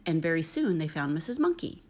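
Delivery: usual speaking voice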